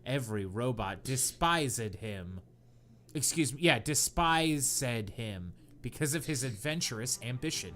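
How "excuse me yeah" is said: ordinary talk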